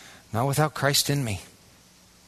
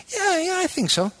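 mock unsure.

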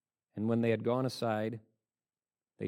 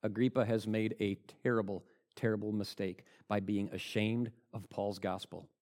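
usual speaking voice

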